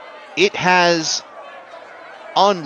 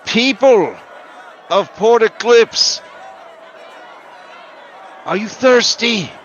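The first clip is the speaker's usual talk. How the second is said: high, loud, slow rally cry; rabble-rousing orator; 10 semitones higher, 6 dB louder than usual. Acted. Sylph orator shouting to the protest crowd, high and loud. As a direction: rouse the crowd from the crates. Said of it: "he is shouting in Susurran".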